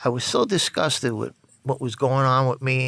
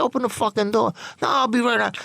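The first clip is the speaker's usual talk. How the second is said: demanding at the door; 9 semitones higher than usual. Retold or imitated. Demanding, friend stalling at door.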